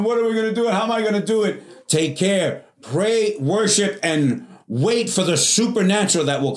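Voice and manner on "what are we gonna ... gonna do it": worried cry. Retold or imitated. Anxious believers quoted.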